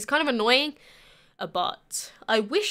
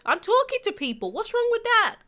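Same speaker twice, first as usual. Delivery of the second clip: high-pitched, defensive comeback; playful protest; 10 semitones higher than usual. Real, not acted. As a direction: protest in a much higher voice. Playful protest at being asked if bored.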